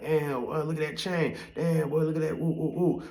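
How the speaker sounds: high, wowed imitation of young onlookers; mock awe. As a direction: ooh and aah like an impressed kid. Acting out impressed young onlookers.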